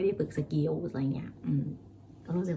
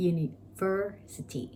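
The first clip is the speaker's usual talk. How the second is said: university drawn out very slowly with ver stressed; slow teaching demo of university; 2 semitones higher, much slower than usual. Shown as a demonstration. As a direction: stretch university out, leaning on ver. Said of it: "stress อยู่ตรงที่ ver นะคะ".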